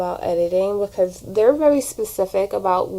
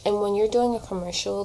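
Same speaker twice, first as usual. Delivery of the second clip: usual speaking voice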